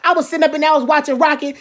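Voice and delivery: ordinary talk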